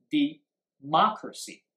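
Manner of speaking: Democracy split after De, MOC stressed; word stress demo. Shown as a demonstration. English teacher showing students where stress falls, stressing MOC. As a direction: pause after "De", then hit MOC in "Democracy". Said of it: "重音放在哪里".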